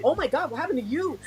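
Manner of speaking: high-pitched retold astonishment; gasp-like mock alarm at a crying face; mock shock.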